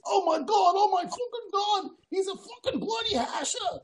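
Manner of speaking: high-pitched mock shout of astonishment; imitated British yelling. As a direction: yell it across the cabin like an excited Brit. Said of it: "yelling across".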